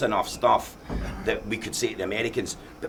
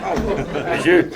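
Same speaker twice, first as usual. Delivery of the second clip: high, loud, slow, laughing; laughing at the mix-up; laughing realisation; 9 semitones higher, 8 dB louder, slower than usual. Retold or imitated. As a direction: let the penny drop through a laugh. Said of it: "I was like".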